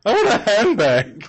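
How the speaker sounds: high-pitched robot shopper. Acted. Robot shopping at the mall, high robot voice. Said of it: "robot voice".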